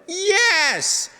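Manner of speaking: put-on high excited shout of a small girl; little girl yelling. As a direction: shout it like an eager four-year-old. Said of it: "Jenny, yelled".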